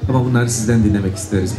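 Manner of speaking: ordinary delivery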